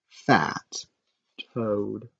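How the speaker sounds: plain reading voice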